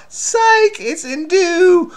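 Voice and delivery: very high, loud taunting cry; spring the trick with a squealed punchline; high-pitched mock triumph, drawn out at the end; gleeful gotcha. Gloating at the fooled puzzler.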